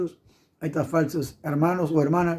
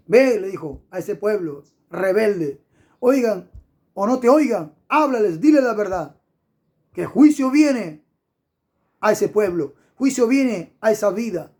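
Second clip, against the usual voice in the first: fiery preaching; 3 semitones higher than usual. Acted. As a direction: thunder the warning from the pulpit. Preacher calls to speak the truth. (predicación encendida, más alta y fuerte)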